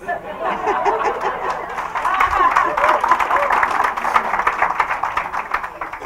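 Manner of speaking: long, bright burst of real laughter; hearty laugh. Real, not acted. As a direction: laugh out loud, freely. Long laugh after her own line.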